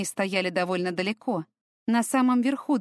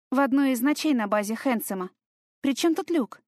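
high, heated indignant protest; 5 semitones higher than usual. A narrator's reading. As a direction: push back hotly, rising. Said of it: "возмущённо".

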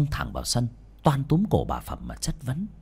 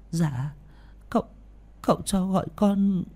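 timid mumble; 9 semitones higher than usual. Acted. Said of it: "Bà run rẩy đi vào lý nhí".

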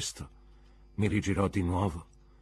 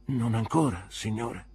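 dolore; 5 semitones higher, 5 dB louder than usual. A narrator's reading. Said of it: "piegandomi per il dolore".

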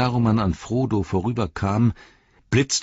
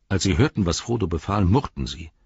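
usual speaking voice

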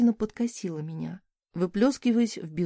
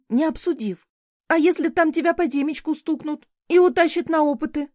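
higher and louder, rising in anger; an angry, raised-voice scolding of a reckless boy; scolding tirade; 6 semitones higher, 5 dB louder than usual. Acted. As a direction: tear into him for running off.

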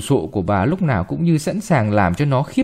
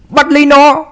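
much higher, louder frantic yell; panicked grandma shouting to catch it; shouting order; 13 semitones higher, 8 dB louder than usual. Acted. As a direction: scream at the girl to grab the cat. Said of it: "Bà gọi thế".

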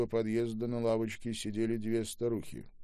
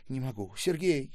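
higher, louder distressed cry; trembling, overwhelmed; 6 semitones higher, 5 dB louder than usual. A narrator's reading. Trembling woman pressed against her son, overwhelmed. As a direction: cry it out, overcome by emotion. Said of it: "дрожала женщина".

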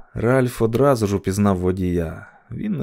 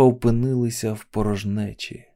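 ordinary delivery

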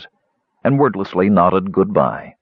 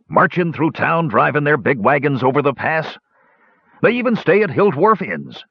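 yelled; 7 semitones higher than usual. A narrator's reading. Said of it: "yelled Hannock".

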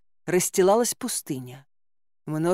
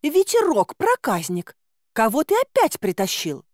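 high scolding wail; indignant woman's cry; 7 semitones higher, 3 dB louder than usual. A narrator's reading. Woman scolding the chick for its catch, indignant high cry. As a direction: cry out, scolding a mischief-maker. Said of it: "возмущённым женским голосом завопил".

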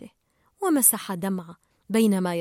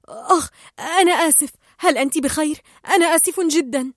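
hurried apology; 5 semitones higher, 6 dB louder than usual. Acted. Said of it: "قال مارلين سريعًا".

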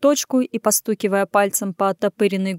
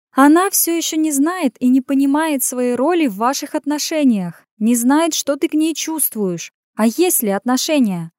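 higher woman's voice, accusing; judgmental outburst; 6 semitones higher than usual. A narrator's reading. Woman scolding a man about their relationship, judgmental and higher. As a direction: blurt it out in reproach. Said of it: "осуждающе выпалила она".

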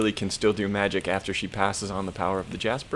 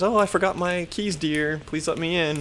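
lightly voiced, higher sweet-old-lady plea; imitated grandmother; 8 semitones higher than usual. Grandmother asking to be let in, imitated higher voice. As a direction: put on a higher voice for Willie.

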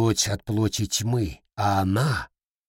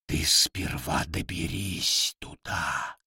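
mocking demon voice; 5 semitones lower, 6 dB quieter, slower than usual. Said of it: "снова прозвучала насмешка".

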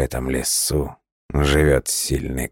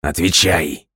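loud, harsh demand; angry command; 4 semitones higher, 5 dB louder than usual. A narrator's reading. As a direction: bark the order. Stranger demands an answer.

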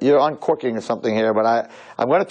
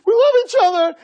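high, hammed-up wailing imitation; crying; 5 dB louder, slower than usual. Fiancée begging boyfriend to sign, hammed-up high-pitched crying. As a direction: wail it through loud mock sobs. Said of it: "she starts crying".

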